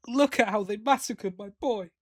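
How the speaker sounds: normal talking voice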